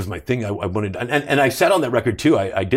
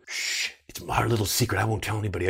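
softer, hushed confiding voice; hushed secret; 7 dB quieter than usual. Retold or imitated. Crew guy murmuring to the actor, hushed and conspiratorial. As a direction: lean in like sharing a secret.

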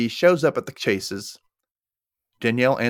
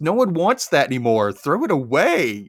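drawn-out, higher-pitched mock disgust; disgusted scolding; 6 semitones higher, 3 dB louder, slower than usual. Host scolds Kyle over the urinal bear, drawn-out disgust. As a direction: scold in exaggerated revulsion, stretching the words.

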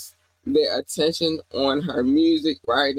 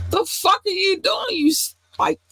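high exasperated disbelief; squealed, fed-up disbelief; incredulous squeal. Real, not acted. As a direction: shriek it in disbelief. Ranting at the woman, peak.

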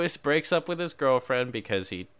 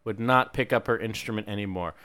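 ordinary delivery

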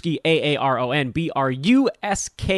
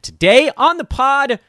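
excited announcer; 10 semitones higher, 5 dB louder than usual. Pretend.

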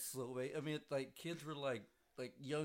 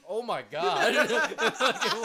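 much louder, laughing through it; laughing mock gasp; 8 semitones higher, 14 dB louder, much slower than usual. Laughing while imitating a man fleeing the smell. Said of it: "He goes".

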